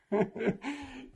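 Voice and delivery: high, bursting real laugh; sudden amused laugh; burst of laughter. Real, not acted. Laughs recalling the men's advice.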